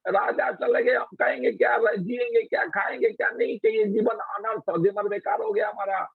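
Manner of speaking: high, fast distraught lament; queens wailing. Retold or imitated. Queens scolding the boy while mourning, high fast wail. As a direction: pour out the grief in a rush.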